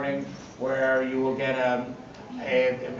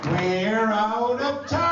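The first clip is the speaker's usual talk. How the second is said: playful shout; 9 semitones higher, 5 dB louder, slower than usual. Acted. Panel closing shout.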